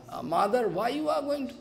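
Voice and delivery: put-on high, soft little-child voice; baby Krishna asking his mother why, high and soft; childlike plea. A narrator's reading.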